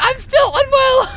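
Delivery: high, loud and slow, dragged out; indignant yell. Retold or imitated. She yells at the mocker she is still unwell, slow and loud. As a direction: stretch it out, outraged.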